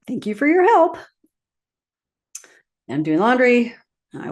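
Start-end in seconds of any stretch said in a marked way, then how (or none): 0.1-1.0 high, laughing thank-you; sing-song giggling thanks to her husband. Retold or imitated. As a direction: laugh through the thanks, pitched way up. Polite brush-off of husband's help.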